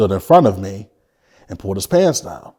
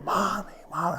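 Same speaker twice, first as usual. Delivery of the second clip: retold child's cry for mom; 9 semitones higher, 11 dB quieter than usual. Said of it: "and I'm screaming".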